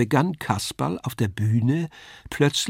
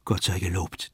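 softer, lower inward relief; relieved thought; 5 semitones lower, 6 dB quieter than usual. A narrator's reading. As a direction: breathe it to yourself. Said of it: "dachte ich". (leise, erleichtert gedacht)

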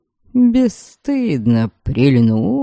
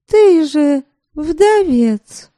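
naive surprise; 10 semitones higher, much slower than usual. Acted. Same girl, still surprised.

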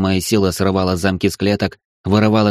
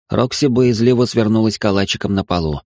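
ordinary delivery

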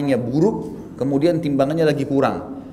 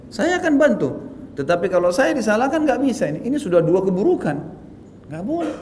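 higher-pitched, firm retold objection; insistent protest; 6 semitones higher than usual. Wrongly blamed driver answering the accusers, insistent retold protest. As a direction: stand your ground against unfair blame.

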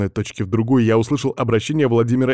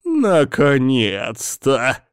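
smiling relief; 3 semitones higher, much slower than usual. A narrator's reading. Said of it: "улыбнулся Всеволод Владимирович".

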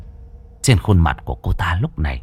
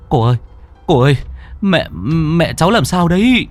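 panicked stammering; 7 semitones higher, faster than usual. A narrator's reading. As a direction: stammer out a frightened, higher-pitched question. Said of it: "Quân lắp bắp". (lắp bắp hoảng hốt)

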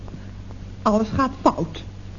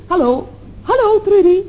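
calling down the line; 8 semitones higher, 4 dB louder than usual. Acted. Phone line drops mid-call.

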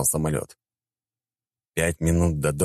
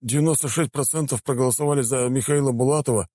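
higher, hesitant official admitting news; awkward mayor; 9 semitones higher than usual. A narrator's reading.

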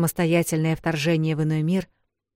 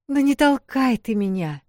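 higher indignant protest; 8 semitones higher, 3 dB louder than usual. Acted. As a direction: protest at being pushed. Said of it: "возмутилась она".

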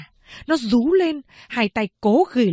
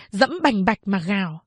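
ordinary delivery, nothing marked

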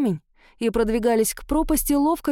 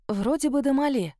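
ordinary delivery, nothing marked

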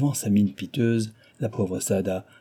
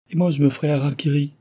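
quick, high-pitched acted wail; tearful outburst; 6 semitones higher, 4 dB louder, faster than usual. A narrator's reading. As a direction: threaten it in acted despair. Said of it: "en éclatant en sanglots". (au bord des sanglots, joué)

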